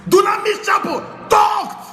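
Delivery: slow, high, ringing shout; shouted declaration. Retold or imitated. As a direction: proclaim it slowly to the crowd. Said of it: "I will say".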